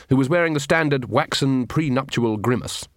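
ordinary talk, nothing marked

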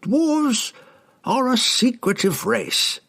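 very high, drawn-out knowing remark; knowing old herald. A narrator's reading. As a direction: savour it like an amused old scholar. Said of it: "with a knowing chuckle".

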